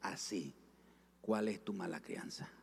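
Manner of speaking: low, soft, measured rebuke; voice of God. Retold or imitated. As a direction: imitate the Holy Spirit's quiet correction. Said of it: "esa voz me habló y me dijo".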